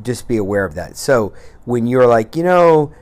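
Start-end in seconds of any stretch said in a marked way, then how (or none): 2.3-2.9 higher, louder mock whine about the scale; whining. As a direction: whine like a frustrated dieter. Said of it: "you do any of your whining".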